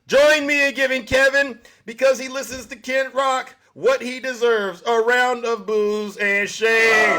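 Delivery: loud, high call to the crowd, last line slowed right down; rallying call. Real, not acted. Streamer calling on his viewers to boo Kevin, loud and high. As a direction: whip the live viewers into booing Kevin.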